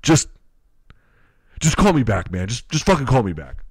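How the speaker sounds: loud, halting mock plea; imitating an exasperated last message; fed-up pleading voicemail. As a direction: beg in bursts like a worn-out caller. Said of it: "He's done yelling at me. He's just like".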